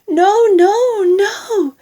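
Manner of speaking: very high, loud panicked cries; desperate wailed protest; crying out. A narrator's reading. Two onlookers trying to stop grandma, high panicked cries. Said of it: "the other two cried".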